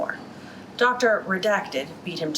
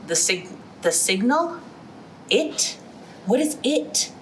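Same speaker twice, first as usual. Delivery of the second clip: confused exclamation; 5 semitones higher, slower than usual. A narrator's reading. Confused board member exclaims at the mysterious signal, higher and startled. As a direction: pitch jumping higher on each stunned question. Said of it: "one of the confused men exclaimed".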